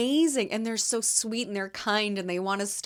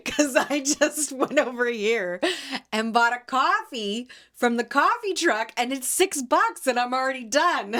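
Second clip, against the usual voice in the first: higher, laughing retelling; 5 semitones higher than usual. Retold or imitated. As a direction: giggle through the customer's line. Retelling a customer's joke, laughing.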